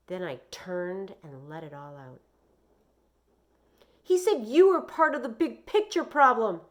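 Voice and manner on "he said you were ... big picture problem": put-on high, loud, upset boy's shout. A narrator's reading. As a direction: yell it out at mom, all pent up. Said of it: "I shouted".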